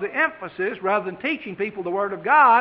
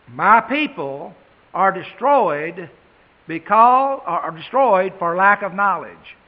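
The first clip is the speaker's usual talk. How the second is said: emphatic scripture reading; 6 dB louder than usual. Shown as a demonstration. Reads verse with emphasis.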